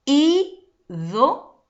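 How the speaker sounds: "Ídolo" in separate syllables, high loud Í, then weaker do and lo; slow "Ídolo", í... do... lo, strongest at the start; falling intensity. Shown as a demonstration. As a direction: hit Í hard in "Ídolo" and let the next syllables drop away. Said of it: "¿Cómo baja la intensidad?".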